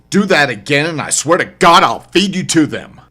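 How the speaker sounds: snarl-yell the threat at her; furious threat. Acted. Narrator threatens the shrieking woman, furious shout.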